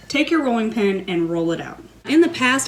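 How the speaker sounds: ordinary talk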